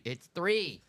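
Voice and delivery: "three" with a clear th; correcting the uncles' "tree". Shown as a demonstration. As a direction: insist on "three" with th. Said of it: "Not tree".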